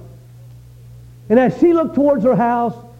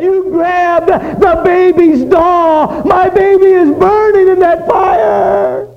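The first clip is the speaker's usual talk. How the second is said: high, loud, slow crying wail; anguished wailing; 7 semitones higher, 6 dB louder, much slower than usual. A narrator's reading. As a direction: sob it out in horror. Mother discovers the doll.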